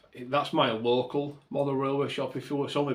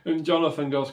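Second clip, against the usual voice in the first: ordinary talk, nothing marked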